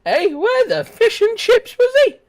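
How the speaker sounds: high-pitched, loud outburst; loud high yell. Real, not acted. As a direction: shout it out high at the screen. Gaming, shouting at screen.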